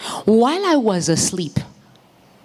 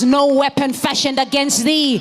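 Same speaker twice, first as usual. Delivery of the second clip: rising scripture proclamation; 6 semitones higher than usual. Real, not acted. Preacher quoting scripture, building.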